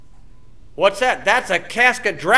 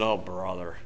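muttered oh brother, much lower and softer; exasperated; 12 semitones lower, 12 dB quieter than usual. Retold or imitated. Preacher hearing about tongues, muttered exasperation. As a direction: sigh it out under your breath.

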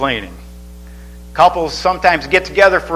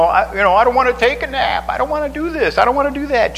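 put-on high whining little kid voice; whiny preschooler; 8 semitones higher, faster than usual. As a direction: whine like a tired toddler. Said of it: "preschoolers complain".

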